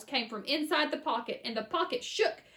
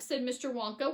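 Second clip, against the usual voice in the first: plain reading voice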